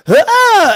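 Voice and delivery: high mock shriek; mock scream. Acting out Gale's scene, a high mock shriek. Acted scream in pitched story.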